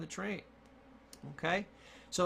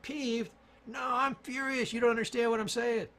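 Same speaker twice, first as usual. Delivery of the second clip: high, loud indignant outburst; raised, incredulous mock fury; acted furious protest; 7 semitones higher, 4 dB louder than usual. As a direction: play someone outraged at being misunderstood. Acted furious person rejects peeved.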